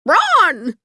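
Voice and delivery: high, loud panicked scream. A narrator's reading. Hen screams at Dorothy to run, panicked. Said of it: "screamed the yellow hen".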